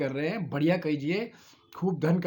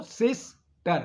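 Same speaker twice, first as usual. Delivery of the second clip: Sister in two slow, higher-pitched beats; split into syllables; 5 semitones higher, much slower than usual. Shown as a demonstration. Teacher recapping two syllables, sister split slowly in two. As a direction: pull sister apart after सिस. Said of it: "दो syllable हो गए".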